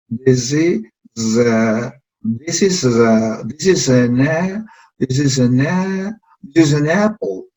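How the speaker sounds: This is an apple built up bit by bit, very slow at first; building up slowly. Shown as a demonstration. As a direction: stretch it out, then join it whole. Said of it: "si lo hicimos de a pocos".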